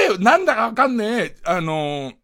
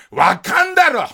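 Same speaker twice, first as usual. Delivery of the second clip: indignant heckle; 8 semitones higher than usual. Pretend. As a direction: heckle the screen from your sofa. Calls out at the film at home.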